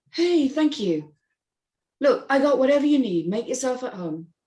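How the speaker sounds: a hint of a charming host welcoming a guest; Myron, party host. Narrator voices Myron at party.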